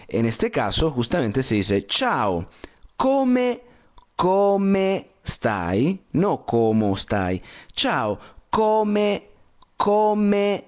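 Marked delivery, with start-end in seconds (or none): 3.0-6.0 "come" sung out much higher, clear final e; Italian come with e. Shown as a demonstration. Teacher models "come stai" for student Diana. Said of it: "con la E". 7.7-10.6 "come" sung out much higher, clear final e; Italian come with e. Shown as a demonstration. Teacher models "come stai" for student Diana. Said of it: "con la E".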